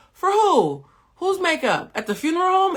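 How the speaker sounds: high, loud, mocking incredulity; incredulous disbelief. Roasting the contestant's makeup job, in shrill mock disbelief.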